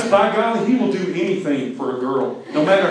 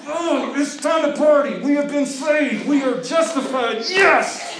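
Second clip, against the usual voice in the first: excited shout; 11 semitones higher than usual. Acted. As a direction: shout it like you've just been saved. Saved people celebrating.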